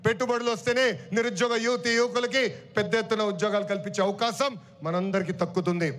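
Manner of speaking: usual speaking voice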